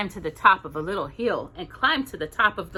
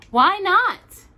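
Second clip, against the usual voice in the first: higher, louder question; Mother Bear asking; 12 semitones higher, 7 dB louder than usual. Acted. Mother Bear asks Little Bear why he cannot sleep, higher. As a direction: ask with lifted voice.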